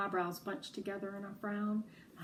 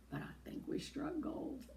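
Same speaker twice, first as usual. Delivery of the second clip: whispered triumph; 7 semitones higher, 9 dB quieter than usual. Acted. Happy secret triumph.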